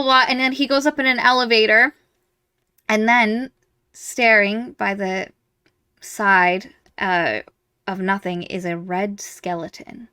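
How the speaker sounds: ordinary talk, nothing marked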